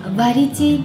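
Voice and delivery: ordinary delivery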